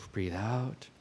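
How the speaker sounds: hushed, on a released breath; soft exhaled instruction. Shown as a demonstration. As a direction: sigh it out very softly. Demonstrates breathing out.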